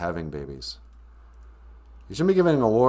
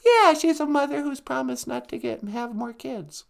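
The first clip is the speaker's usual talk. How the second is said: high little character voice; argumentative sidekick character. Acted. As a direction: counter him in a squeaky puppet voice.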